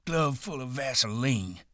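put-on high-pitched old man's jeer; gleefully disgusted taunt. A narrator's reading. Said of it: "he said disgustedly".

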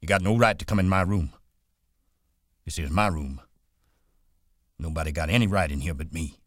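sharp and fast, pushing him out. A narrator's reading. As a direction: snap it at the intruder. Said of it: "Crooks said sharply".

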